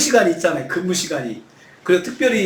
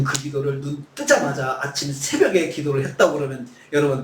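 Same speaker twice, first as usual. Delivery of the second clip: normal talking voice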